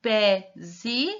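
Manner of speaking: pezi in separate beats, pe, pause, zi; sounded out. Shown as a demonstration. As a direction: sound out pezi as an unfinished word, syllable by syllable. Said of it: "a palavra está escrito assim". (pezi separado em pe, pausa, zi)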